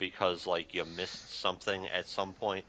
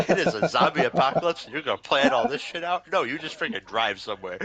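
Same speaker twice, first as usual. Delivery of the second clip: loud, high words broken by real laughter; laughing rant; 5 semitones higher, 9 dB louder than usual. Real, not acted. Reviewer mocking a zombie show's plot, laughing rant. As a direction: crack up while ranting in disbelief.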